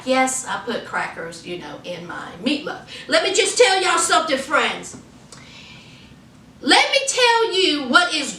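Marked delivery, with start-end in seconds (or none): 0.0-2.8 lower, softer, unbothered; cool low reply. Retold or imitated. Mia answering Bill about her meatloaf, cool and quiet. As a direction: shrug it off quietly.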